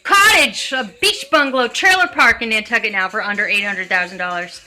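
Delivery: hammed-up loud rant about real estate; mock rant. Shown as a demonstration. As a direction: vent loudly about the parents.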